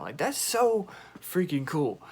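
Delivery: barely holding in the excitement; freaking out. Real, not acted. Fan meeting her favorite band, thrilled and gushing. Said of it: "I was low-key freaking out".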